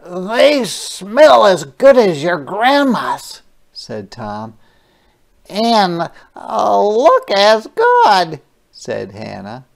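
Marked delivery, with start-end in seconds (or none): 0.0-3.4 put-on child's voice. Tom praising the cookies. 5.5-8.4 put-on child's voice. Hannah praising the cookies.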